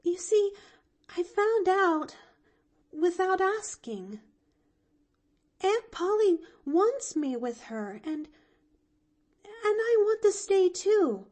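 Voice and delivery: put-on high, earnest girl owning up; little girl gathering her courage; brave confession. A narrator's reading. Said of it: "she confessed bravely".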